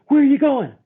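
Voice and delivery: put-on alarmed girlfriend. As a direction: catch him as he heads out the door. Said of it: "And she goes".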